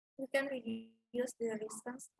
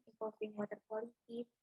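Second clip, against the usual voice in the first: ordinary delivery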